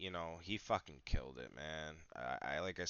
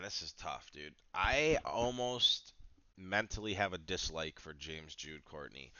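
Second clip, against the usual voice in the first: normal talking voice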